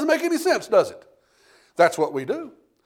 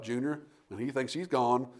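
normal talking voice

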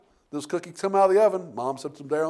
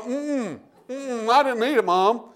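mouthful denial; 6 semitones higher, slower than usual. Retold or imitated. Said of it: "with a mouthful of cookie ... going".

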